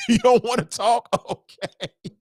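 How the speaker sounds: high-pitched, laughing mock surprise; gleeful parent. Parent relieved kid won't talk, high and laughing. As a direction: happily accept it through a laugh.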